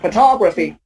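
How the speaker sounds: Photography as pho-TOG-ra-phy; teacher's pho-TOG-raphy; stress before graphy. Shown as a demonstration. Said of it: "the stress falls before graphy".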